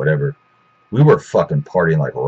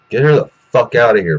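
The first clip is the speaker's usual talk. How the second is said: angry demand; 6 semitones higher, 5 dB louder than usual. Retold or imitated. Demanding security remove her.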